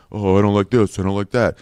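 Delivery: low, grumbling imitation of a negative person; mock complaining. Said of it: "Everything's a complaint with those people".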